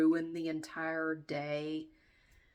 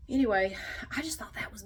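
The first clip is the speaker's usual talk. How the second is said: whispered aside; 2 semitones higher than usual. Real, not acted. Telling viewers what she really thinks, conspiratorial whisper. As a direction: lean in and whisper the verdict.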